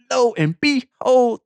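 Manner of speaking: storyteller's high build-up; dramatic lo and behold. Real, not acted. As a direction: stretch it out for suspense. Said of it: "And lo and behold".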